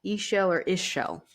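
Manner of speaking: Ishel first as EE-shel then as ISH-el; both pronunciations again. Shown as a demonstration. Tarot reader repeating the name both ways, EE-shel then ISH-el. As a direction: Ishel with long ee, then Ishel with short i. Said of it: "That's how you pronounce that".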